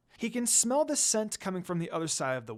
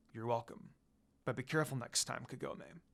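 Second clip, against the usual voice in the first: smirking demon lord; 5 semitones lower, 9 dB quieter than usual. A narrator's reading. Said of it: "Sesshomaru said with a smirk".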